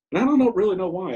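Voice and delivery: flare of exasperation. Real, not acted. Recalling his anger at his wife, bursting out loud and high.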